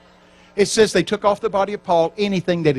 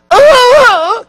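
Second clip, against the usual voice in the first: sudden high, loud scream of pain; 14 dB louder than usual. Retold or imitated. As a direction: yell out as if it hurts with a tube in the throat. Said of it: "my mother starts going".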